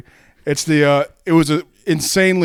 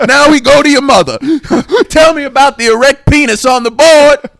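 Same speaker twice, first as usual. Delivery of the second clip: loud, high mock scolding; comic schoolmaster tirade at full volume; Steve Harvey; 11 semitones higher, 12 dB louder than usual. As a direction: bellow it like an outraged teacher. Harvey scolding a student in a bit.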